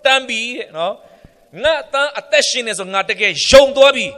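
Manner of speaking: loud, high, excited declaration of belief; Jacob exclaiming. Retold or imitated. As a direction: proclaim it like the overjoyed father. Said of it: "ယာကုပ်ကလည်း".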